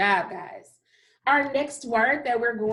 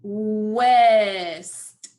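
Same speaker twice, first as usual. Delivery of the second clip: drawn-out word; 6 dB louder than usual. Shown as a demonstration. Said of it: "That sounds like one of our blends".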